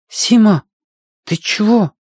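put-on high, startled boy's voice; anxious boy. A narrator's reading. Boy to his waiting dog Сима, anxious.

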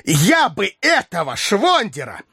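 high, slow, vehement threat; furious exclamation. A narrator's reading. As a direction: spit out the threat. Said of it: "воскликнул ... яростно".

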